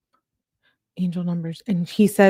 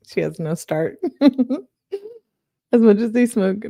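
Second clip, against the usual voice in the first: laughing; 4 semitones higher than usual. Real, not acted. Laughing while reading texts.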